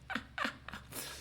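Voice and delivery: airy unvoiced giggle; a quick breathless laugh; breathy laugh. Real, not acted. Laughs at own joke.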